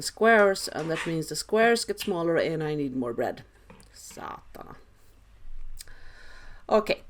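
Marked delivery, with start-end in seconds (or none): 3.9-4.7 whispered swear under breath; muttered curse. Real, not acted. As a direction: curse quietly to yourself. Cursing at a dented loaf.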